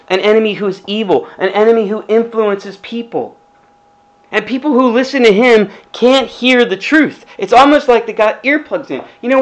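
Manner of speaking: normal talking voice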